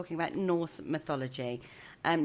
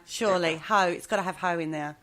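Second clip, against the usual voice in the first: usual speaking voice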